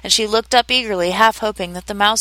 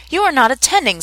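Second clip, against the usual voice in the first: severe scolding, pitched higher; 6 semitones higher than usual. A narrator's reading. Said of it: "Alice severely".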